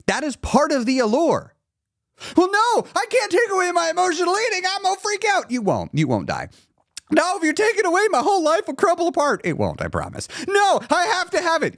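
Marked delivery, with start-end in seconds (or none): 2.4-5.5 very high, hurried whiny freak-out; mock panicked protest. Emotional eater refusing to give it up, mock panicked protest. 7.1-9.4 very high, hurried whiny freak-out; mock panicked protest. Emotional eater refusing to give it up, mock panicked protest. Acted addict protesting, life will crumble. 10.5-11.8 high, whiny mock-desperate insistence; desperate addict. Emotional eater told they'll be fine, desperate addict. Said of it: "You sound like an addict".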